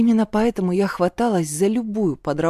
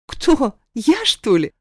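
higher-pitched, incredulous comeback; indignant snort; 5 semitones higher, 4 dB louder, slower than usual. A narrator's reading. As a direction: snort back in mild indignation. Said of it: "фыркнула я". (возмущённо фыркая)

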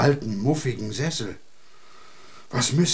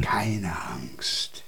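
whispered, much softer reassurance; soothing whisper; 8 semitones lower, 6 dB quieter than usual. Acted. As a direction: hush her fears gently. Man calms frightened girl. (leises, beruhigendes Flüstern)

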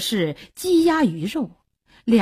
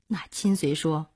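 ordinary delivery

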